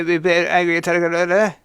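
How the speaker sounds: ordinary talk, nothing marked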